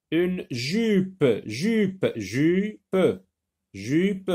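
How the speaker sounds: put-on jupe, each one articulated for children; modelling a word. Shown as a demonstration. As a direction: repeat jupe crisply for young readers. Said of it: "le son J s'écrit avec la lettre J".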